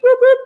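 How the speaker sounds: high "whoop whoop" siren noise; two quick high whoops like a squad car; imitated police siren. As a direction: mimic a short police siren burst.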